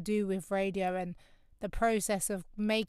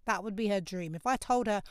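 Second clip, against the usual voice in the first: ordinary delivery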